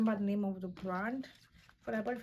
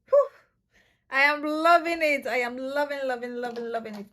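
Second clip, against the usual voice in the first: excited gush; 10 dB louder than usual. Real, not acted. Delighted by lashes.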